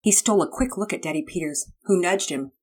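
usual speaking voice

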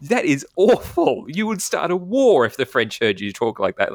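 high, drawn-out mock disgust; appalled wife. Wife judging his French poem, mock appalled. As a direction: slam the recital as a horrified critic. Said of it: "she's like".

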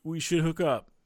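hammed-up high, loud flirt; mock creepy pickup line. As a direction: overeager guy hitting on her. Mocking the overeager guy in store.

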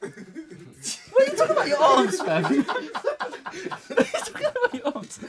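high, laughing quote through giggles. Retold or imitated. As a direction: laugh out the words. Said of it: "I'm like".

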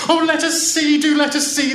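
put-on high, loud pleading children; eager cry. A narrator's reading. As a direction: beg loudly and eagerly to see. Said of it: "the children cried".

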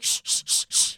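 hushed shushing. Retold or imitated. Boyfriend shushing her at the exhibit, hushed. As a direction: shush someone sternly. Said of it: "He's like".

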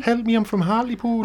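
high, pleading put-on Hartlepool voice; Hartlepool accent. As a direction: beg for help in a silly local voice.

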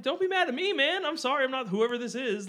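pleading apology. Retold or imitated. Telling the stranger sorry in a high pleading voice. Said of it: "And I'm like".

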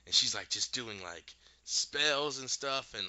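usual speaking voice

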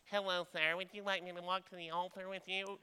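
lightly voiced timid nerd, a put-on voice; mock polite nerd. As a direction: play the awkward kid asking politely. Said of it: "that's what I felt like".

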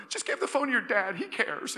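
high, loud imitation of a distraught caller; mock frantic plea over the phone; retold hysterical crying. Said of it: "she's crying hysterically".

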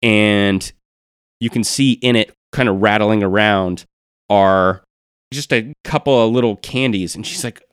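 ordinary delivery

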